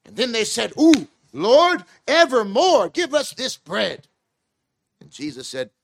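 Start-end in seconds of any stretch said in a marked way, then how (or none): 0.8-4.1 high, loud pleading cry of the crowd. Retold or imitated. Preacher voicing the crowd begging Jesus for bread, high loud plea. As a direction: beg the Lord like a hungry crowd.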